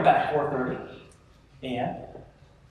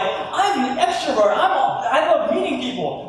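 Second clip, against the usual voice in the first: gushing extrovert; 10 semitones higher, 4 dB louder than usual. Retold or imitated. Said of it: "Others of us are like".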